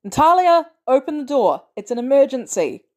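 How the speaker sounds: loud, high, urgent calling; desperate loud plea; urgent shout. A narrator's reading. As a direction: yell through the door for help. Pounding on door, calling out.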